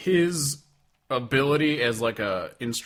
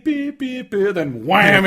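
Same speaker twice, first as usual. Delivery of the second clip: imitated beeps; 8 semitones higher, 7 dB louder, slower than usual. Imitating nineties song intro.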